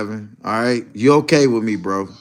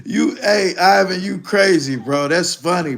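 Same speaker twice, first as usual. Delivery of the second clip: normal talking voice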